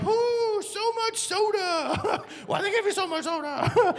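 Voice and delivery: high, drawn-out fake groan; faking a lie. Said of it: "I decided to come up with a lie".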